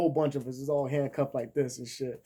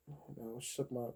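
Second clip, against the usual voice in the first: normal talking voice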